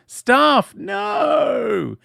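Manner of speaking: high, drawn-out dramatic cry; mock shout. Host calls to Staff in the episode, mock shout. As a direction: cry out in hammed-up horror.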